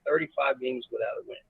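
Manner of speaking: quiet repeat. Real, not acted. Coach repeating the winless streak to the host, quietly. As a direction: drop the volume right down.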